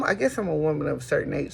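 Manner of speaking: ordinary delivery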